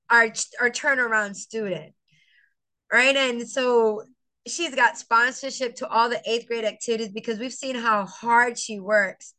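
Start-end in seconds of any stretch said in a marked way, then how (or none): none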